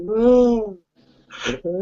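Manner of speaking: high, exaggerated weepy moan; mock crying. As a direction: imitate a film hero's whiny sobbing. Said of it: "he's crying, like, on the bridge".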